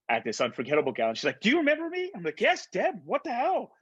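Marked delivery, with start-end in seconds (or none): 1.4-2.1 eager greeting. Retold or imitated. Deborah greets him at gala. 2.4-3.7 surprised. Retold or imitated. Surprised reply to old friend.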